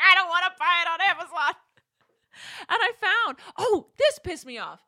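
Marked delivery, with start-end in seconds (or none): 0.0-1.5 exasperated. Retold or imitated. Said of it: "'cause I was like".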